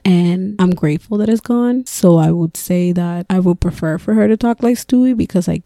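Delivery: normal talking voice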